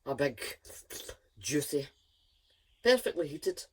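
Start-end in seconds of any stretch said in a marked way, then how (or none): none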